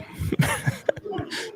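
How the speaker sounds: a squeaky airless laugh, overcome; high breathless teary laugh. Real, not acted. Streamer thanking generous viewers, a high teary laugh. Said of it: "as I'm near tears".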